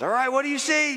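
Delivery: high, barking boss voice; impatient commander calling up to the wall; boss questioning. Acted. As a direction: play the demanding boss up high. Boss voiced in imagined scene.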